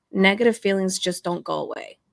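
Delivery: ordinary delivery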